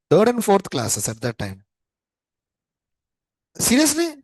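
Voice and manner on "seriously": very high-pitched astonished question; real disbelief. Real, not acted. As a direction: let the pitch leap in disbelief. Astonished by students' class back then.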